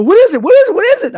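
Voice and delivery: frantic, high retold shouting; re-enacted panicky kid, loud and shrieking; terrified. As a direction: demand it over and over in mock panic. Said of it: "I'm, like, terrified".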